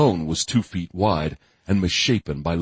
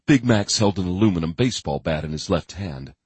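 usual speaking voice

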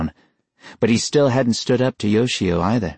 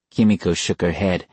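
ordinary delivery, nothing marked

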